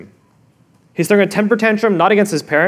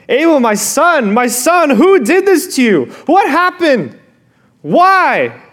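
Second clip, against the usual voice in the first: higher, anguished lament; weeping parent; 7 semitones higher, 4 dB louder, slower than usual. Retold or imitated. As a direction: cry out over a dead son.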